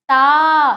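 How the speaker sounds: ता called out loud with a long aa. Shown as a demonstration. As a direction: call ता with the vowel held. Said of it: "तो ता का क्या हो गया".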